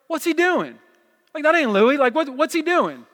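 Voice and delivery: high, loud puzzled outcry; mock bafflement. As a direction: play a baffled churchgoer questioning the preacher. Imagined baffled congregation reacting.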